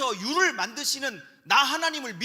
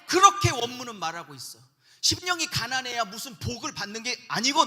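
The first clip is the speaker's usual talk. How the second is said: ordinary talk, nothing marked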